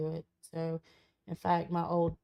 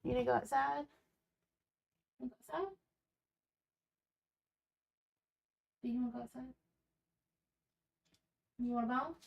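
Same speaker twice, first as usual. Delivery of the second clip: higher, sing-song voice for the dog; high pet-talk voice; 5 semitones higher, 7 dB quieter, much faster than usual. Real, not acted. Talking to her dog.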